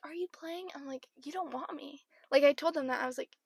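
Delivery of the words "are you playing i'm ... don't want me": very soft acted question and reply; hushed retold exchange. Coaches asking her to play, hushed retelling. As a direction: keep the back-and-forth under your breath.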